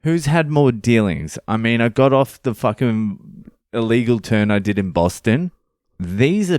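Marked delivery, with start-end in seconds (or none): 0.0-1.4 retort. Retold or imitated. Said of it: "I'm like".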